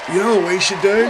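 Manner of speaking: high, loud and fast, an over-eager bloke; mock sensitive Aussie bloke. Mocking a sensitive Aussie bloke who pitches group therapy to the boys, high and loud. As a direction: sell the group therapy idea to your mates like a caricature.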